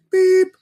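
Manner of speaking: high, loud vocal beep; sharp high-pitched "beep"; imitated censor beep. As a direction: mimic a bleep tone with your voice. Speaker self-censors name with imitated beep.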